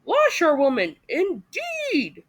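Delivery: outraged shout at being called a washerwoman; reckless shout. A narrator's reading. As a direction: fling it out with wild pride. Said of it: "he shouted recklessly".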